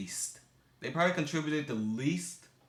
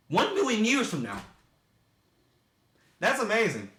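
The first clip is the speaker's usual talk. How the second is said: higher and louder, full of wonder; amazed; 10 semitones higher, 6 dB louder than usual. Real, not acted. He marvels at Mayan star predictions, excited and amazed. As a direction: marvel at it out loud.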